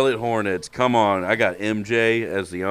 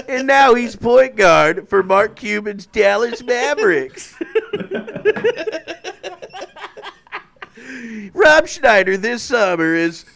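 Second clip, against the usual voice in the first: mock announcer; 13 semitones higher, 7 dB louder than usual. Mock announcer about Luka.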